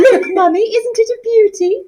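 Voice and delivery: put-on high, far-off girlish voice; posh Sheila. Shown as a demonstration. Colleague asked to voice Sheila, high girlish squeal. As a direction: show off the ring like a spoilt daughter. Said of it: "Miss, you just say it".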